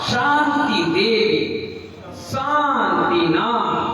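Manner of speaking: शांतिनाथ drawn out slowly with a hissing sh; slow sh demo. Shown as a demonstration. Teacher models slow शांतिनाथ with a hissing sh. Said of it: "इसे कहते हैं मूर्धन सा".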